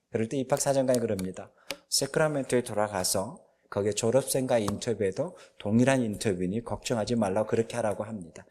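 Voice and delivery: ordinary talk, nothing marked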